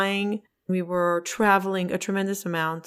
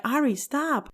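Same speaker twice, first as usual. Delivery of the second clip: higher-pitched, annoyed plea; retold annoyance; 6 semitones higher than usual. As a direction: tell him to quit it, irritated. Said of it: "I was, like, getting really annoyed".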